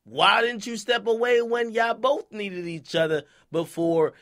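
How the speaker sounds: usual speaking voice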